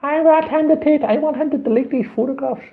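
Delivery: put-on high, loud whiny girl's voice; imitating the girl insisting to the police; mock girl demanding. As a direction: pitch way up like a demanding young woman. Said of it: "She's going".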